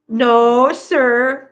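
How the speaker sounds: loud, drawn-out reprimand; pet scolding. Real, not acted. Owner stopping a pet's fussing, loud firm scolding.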